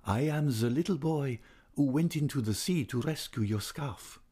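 put-on young man. A narrator's reading. Kneeling young man reminding the singer, lightly voiced suitor. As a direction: lift the voice into an earnest young man's. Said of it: "replied the young man".

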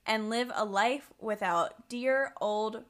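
normal talking voice

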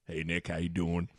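deep, hushed coach greeting; Coach Altman. Retold or imitated. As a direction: imitate the coach, low and mumbled. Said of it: "do the impression".

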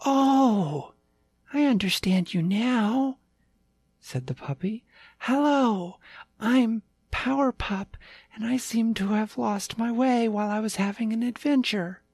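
high, eager little puppy; a lost small pup, chirpy and sweet; yappy panting puppy. Acted.

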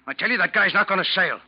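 loud, high and fast, storming off; furious shout. Acted. First mate furiously vowing to go confront the new mate. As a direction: charge out the door in a rage.

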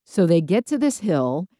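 ordinary talk

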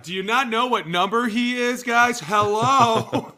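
loud, high, incredulous call to the guys; exasperated shout. Real, not acted. Host shouting at the audience, exasperated and loud. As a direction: yell at them in disbelief. Exasperated about Cooper Kupp's number.

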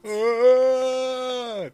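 loud high sustained wail; cartoonish bird imitation of a crying man, loud and high; mock crying wail. Reader demonstrates the bird's crying sound, loud mock wail. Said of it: "the sound of a grown man crying".

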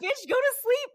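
high-pitched, exasperated bossy scold; mock scolding. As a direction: order her to bed like a fed-up friend. Scolding friend to go sleep.

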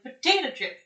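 loud, emphatic "Potato chips"; loud mock insistence. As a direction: insist on it loudly.

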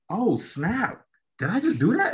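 a jolt of thrilled surprise; amazed. Retold or imitated.

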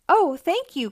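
high, excited thanks; grateful cry. A narrator's reading. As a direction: cry out with relief and gratitude. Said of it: "cried Jenny".

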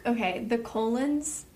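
usual speaking voice

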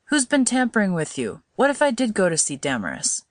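mildly impatient son; a touch of exasperation with his mother. A narrator's reading. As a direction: chide her impatiently, understated. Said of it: "said Chester impatiently".

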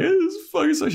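very high whimpering mimicry; mock crying. Buffy running away in tears, mock crying. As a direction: squeal it like a hammed-up cry. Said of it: "like, crying".

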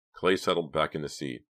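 usual speaking voice

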